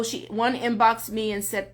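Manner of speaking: ordinary talk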